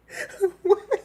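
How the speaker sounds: high laugh. Real, not acted. Vlogger cracking up at her story, high laugh.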